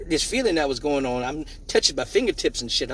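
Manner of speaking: ordinary talk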